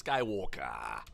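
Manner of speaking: low, stretched-out transatlantic drawl; Palpatine. Shown as a demonstration. Demonstrating the emperor's way with a name, transatlantic villain accent. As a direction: say it deep and slow in a transatlantic accent. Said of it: "the way he says".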